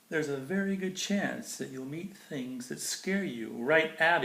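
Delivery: bright, lilting storybook read; sing-song picture book reading; reading to children. Acted. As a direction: read it like bedtime verse for a child. Reads Dr. Seuss to kids.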